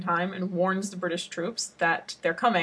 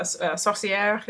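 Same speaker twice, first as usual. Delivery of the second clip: French accent. Shown as a demonstration. French word in English talk.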